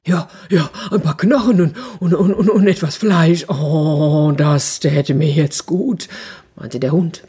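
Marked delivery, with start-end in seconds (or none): 0.1-6.1 eager, stammering dog voice; dog longing for a meal, slowing at the end; hungry dog. Acted. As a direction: drool over the thought of food.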